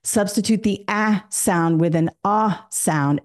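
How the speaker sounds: slow, first "ah" as in cat, second "ah" as in father; isolated vowel sounds. Shown as a demonstration. Teacher tells students to swap sounds, slowly isolating each "ah". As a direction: contrast the two "ah" vowels, front then back. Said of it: "I say".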